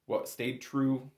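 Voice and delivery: ordinary talk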